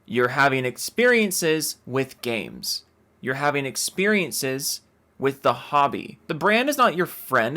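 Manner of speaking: ordinary talk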